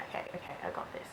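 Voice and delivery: quick conspiratorial whisper, retold; whispered reassurance. Mum secretly taking charge, fast imitated whisper.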